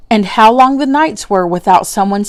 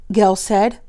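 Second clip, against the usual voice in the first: plain reading voice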